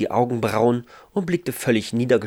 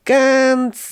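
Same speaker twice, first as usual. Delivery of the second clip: "ganz" held long and higher; drawn-out emphasis; 5 dB louder than usual. A narrator's reading. Ralf reaches carefully for the cloud, "ganz" drawn out slowly. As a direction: stretch "ganz" out before the gentle touch. Narrator stretches the gentle touch.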